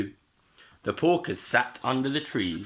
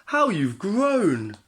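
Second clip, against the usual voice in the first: higher, slow admiring cry; delighted exclamation; 10 semitones higher, 4 dB louder, slower than usual. A narrator's reading. Missus Wolf marvels at her son, high and slow. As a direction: marvel at your grown child. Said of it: "she cried".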